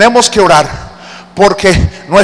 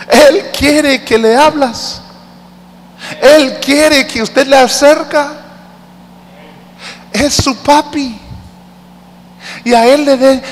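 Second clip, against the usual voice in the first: fervent preacher pleading; impassioned preaching; 6 semitones higher than usual. Real, not acted. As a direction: plead with the congregation at full force. Preaching climax.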